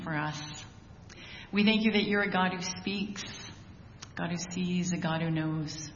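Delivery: prayer. Acted. Pastor opening the service in prayer, soft and reverent. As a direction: pray gently over the church.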